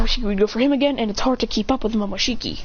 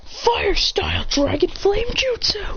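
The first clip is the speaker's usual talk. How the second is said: high, slow anime-style move call; mock attack call; 9 semitones higher, slower than usual. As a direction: draw out the technique name, pitched high.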